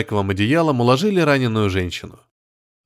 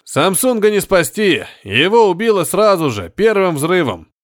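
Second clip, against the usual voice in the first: urgent orders; 6 semitones higher than usual. Acted. Urgent shouting amid wreckage.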